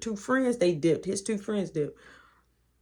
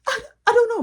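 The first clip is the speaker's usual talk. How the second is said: flustered panic; 6 dB louder, faster than usual. Retold or imitated. Panicked answer to grandpa.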